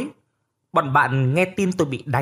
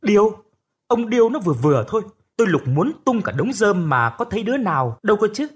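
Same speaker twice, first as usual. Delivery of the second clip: indignant outburst; 7 semitones higher than usual. Acted. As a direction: leap up and call it a lie. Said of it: "nhảy dựng lên".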